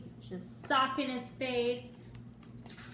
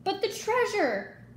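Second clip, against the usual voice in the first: much higher, louder dismayed holler; 9 semitones higher, 4 dB louder than usual. A narrator's reading. Captain dismayed about the treasure in the storm, loud holler. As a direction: wail for the lost treasure. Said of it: "hollered Braidbeard".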